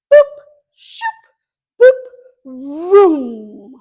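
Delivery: short pops and a swish, ending in a drawn-out vroom; drawing sound effects. Shown as a demonstration. Teacher drawing a lowercase d, making sound effects for strokes. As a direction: sound out each stroke, swelling loud on vroom. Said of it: "boop and a straight line".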